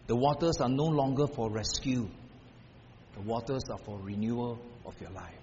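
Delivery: low, soft, unhurried comfort; God's gentle voice. Retold or imitated. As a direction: let the words settle like calm water.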